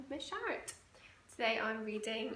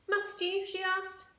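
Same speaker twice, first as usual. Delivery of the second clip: mother; 9 semitones higher, 4 dB louder than usual. Acted. Mother weary reply.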